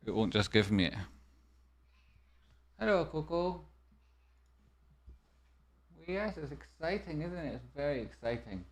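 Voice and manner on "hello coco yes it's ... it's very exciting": talking to the dog. Real, not acted.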